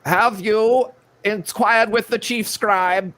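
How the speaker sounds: high, slow, fussy official; bureaucrat voice. Pretend. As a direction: play a pedantic clerk. Voicing a floating bureaucrat figure.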